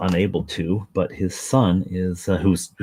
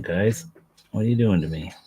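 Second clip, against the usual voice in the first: normal talking voice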